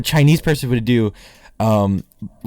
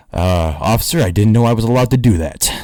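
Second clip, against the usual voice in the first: comic low voice of the innocent driver; 4 semitones lower, 3 dB louder than usual. Retold or imitated. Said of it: "Dave's friend just turns to him".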